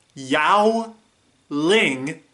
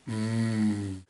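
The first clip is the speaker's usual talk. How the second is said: low, soft "mmm"; savouring hum; 5 semitones lower, 8 dB quieter than usual. Acted. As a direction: hum softly over imagined food. Savouring the foods just listed.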